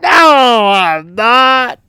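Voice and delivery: high, loud, drawn-out denial; mock-indignant denial. Crooked referee denying he cheats, drawn-out mock indignation. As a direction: stretch out a loud, fake protest.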